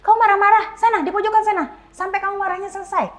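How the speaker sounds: sharp scolding parent; stern time-out order. Retold or imitated. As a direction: order the angry child away. Parent sending child to time-out.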